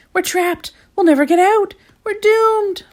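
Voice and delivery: high, loud panicked shriek. A narrator's reading. Mister Worry shrieking in panic, lost inside the pyramid. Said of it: "shrieked Mister Worry".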